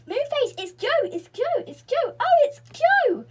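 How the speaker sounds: high, loud, joyful calling out; excited yelling. A narrator's reading. The sisters recognise Joe's voice, excited high yelling. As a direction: cry out to Moonface in delight. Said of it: "yelled out softly".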